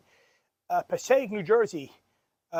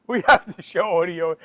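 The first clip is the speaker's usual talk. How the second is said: normal talking voice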